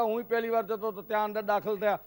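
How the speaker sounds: usual speaking voice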